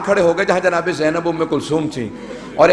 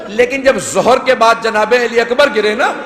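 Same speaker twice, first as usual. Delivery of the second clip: rising mourning lament; 4 semitones higher than usual. Acted. Preacher mourning Ali Akbar to the gathering, raised lament. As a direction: climb into the lament for the fallen son.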